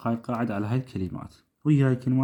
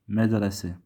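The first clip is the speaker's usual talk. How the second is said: ordinary talk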